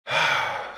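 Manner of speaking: breathy, voiceless sigh; heavy exhale before speaking. Acted. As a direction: let out a long breath. Pause before admitting need to smoke.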